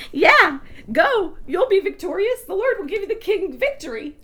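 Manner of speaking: high-pitched sarcastic go-ahead. Retold or imitated. Micah sarcastically promising the king victory in battle. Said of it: "Micah replied sarcastically".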